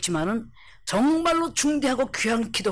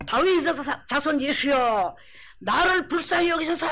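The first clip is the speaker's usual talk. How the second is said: repeated crying out; 4 semitones higher than usual. Acted. Blind beggar calls to Jesus once more, shouting. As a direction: shout it out, holding the last syllable long. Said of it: "이 말을 한 번만 했겠어요".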